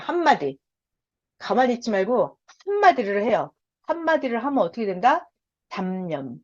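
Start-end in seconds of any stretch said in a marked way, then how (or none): none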